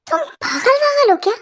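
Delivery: high disbelieving question; are you out of your mind; incredulous. Acted. Mother asks the girl, astonished, if she is crazy. Mom stunned by girl's plan.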